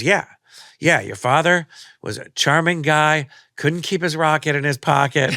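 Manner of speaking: mock old lady relishing the gossip; gossipy old lady. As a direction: dish the dirt like a knowing old lady.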